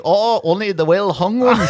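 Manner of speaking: hammed-up Irish film reply; imitating film Irishman. Film Irishman answering about singing, hammed-up mock-Irish boast. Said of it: "do all Irish people sing like you?".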